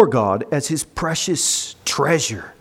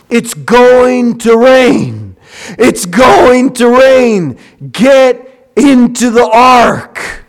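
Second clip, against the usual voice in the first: slow, loud, high warning cry; slow, pleading preacher's cry; urgent preaching; 7 semitones higher, 8 dB louder, much slower than usual. Acted. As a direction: call them into the ark before the rain. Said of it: "preaching warning of the flood".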